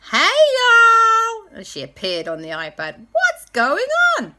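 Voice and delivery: very high, loud Nashville greeting; Nashville accent. Acted. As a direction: call out a big Southern hello. J B greets on iPad.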